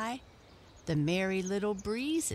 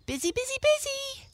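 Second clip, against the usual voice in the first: high, fast, chirpy chipmunk voice; busy chipmunk; 5 dB louder, faster than usual. A narrator's reading. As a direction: rattle it off like a hurried little chipmunk. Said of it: "snapped his bright eyes at them and said".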